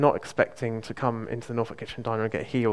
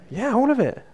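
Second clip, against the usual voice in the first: excited; 11 semitones higher, 4 dB louder than usual. Retold or imitated. Lady confirms pain fully gone.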